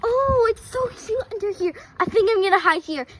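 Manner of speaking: ordinary delivery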